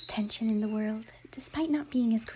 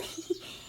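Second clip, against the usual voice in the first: a quiet, breathless giggle; a shy pony giggling under her breath; soft giggle; 7 dB quieter than usual. Acted. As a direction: giggle softly at your own joke. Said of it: "Her soft giggle".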